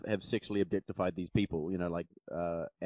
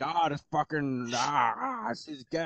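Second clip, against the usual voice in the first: high, drawn-out mock wail; freaking-out fanboy; 8 semitones higher, slower than usual. Panelist mimics fanboys upset over a character, hammed-up freak-out. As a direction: whine like a fanboy in meltdown. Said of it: "all these fanboys are freaking out".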